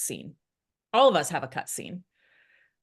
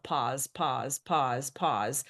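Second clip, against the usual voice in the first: calm yourself down with it; self-reminder; 4 semitones lower, 5 dB quieter, much slower than usual. Retold or imitated. Reminding herself to let the other person speak, low and soft. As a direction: lower and softer, each "pause" spaced out. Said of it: "constantly remind myself".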